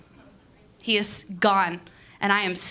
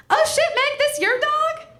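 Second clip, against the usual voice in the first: much higher, louder chummy voice; surprised friendly woman; 4 dB louder than usual. Retold or imitated. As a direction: pitch way up like a casual old acquaintance. Animal control woman recognizes her.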